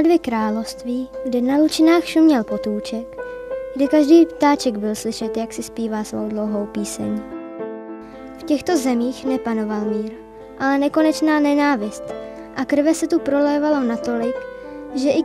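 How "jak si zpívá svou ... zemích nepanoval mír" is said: ordinary delivery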